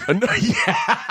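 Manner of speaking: high slow laughing reply. Real, not acted. Laughing at [name] guess.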